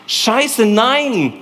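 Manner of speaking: holy anger breaking out; angry outburst. Acted. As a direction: spit it out in sudden anger. Outburst against resignation.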